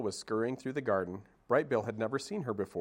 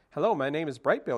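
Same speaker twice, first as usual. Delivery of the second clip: lightly voiced little gosling, higher and louder; a proud small bird introducing himself; a hint of an eager young goose; chirpy gosling; 6 semitones higher, 6 dB louder than usual. Gosling greets anyone.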